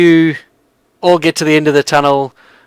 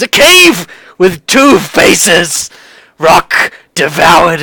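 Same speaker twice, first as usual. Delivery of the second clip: slow, raised, booming proclamation; seer's prophetic outburst; 3 semitones higher, 4 dB louder, much slower than usual. Acted. Seer cries out omens without waiting for reply, slow and fervent. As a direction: proclaim each omen slowly and forcefully.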